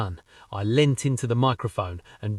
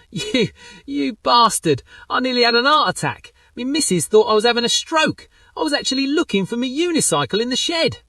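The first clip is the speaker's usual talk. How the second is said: much higher, flustered relieved Barry voice; pranked chip shop owner; 11 semitones higher, 4 dB louder than usual. Retold or imitated. Barry laughing off the prank call, high flustered voice. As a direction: sputter with shocked relief.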